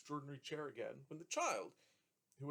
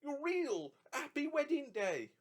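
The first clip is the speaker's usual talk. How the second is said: put-on joyful old man; 12 semitones higher, 5 dB louder, slower than usual. A narrator's reading. Trotty voiced, joyful.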